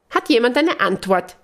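high chirpy question; high bird-like twitter; chirping. Acted. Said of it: "zwitschert Franzi".

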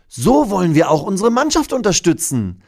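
hohe, lautere Mädchenstimme; erklär es stolz dem Dino; eifriges Mädchen. Acted. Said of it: "erklärt Mathilda dem Dino". (hohe, stolze Mädchenstimme)

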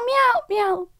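high pained meows; cat meowing. Retold or imitated. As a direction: meow high, cat in pain. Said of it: "dann hört man nur so".

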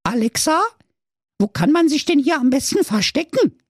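put-on high childlike voice; squeaky little goat kid. A narrator's reading.

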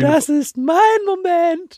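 high, slow, hammed-up diva voice. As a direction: announce your big moment like a star. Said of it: "mocking partner's selfishness".

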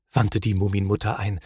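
plain reading voice

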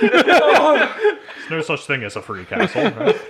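laughing. Real, not acted. Laughing joke.

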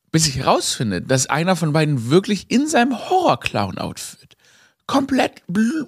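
normal talking voice

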